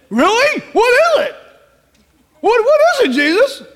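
音调很高，急切追问; 兴奋又迫切地连连发问; eager high excitement. Pretend. Eager mechanic asks Jesus.

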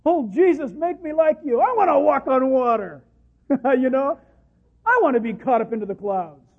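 loud, eager, hammed-up pleading to Jesus; mocking a showy believer's prayer; mock grand prayer. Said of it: "in that request".

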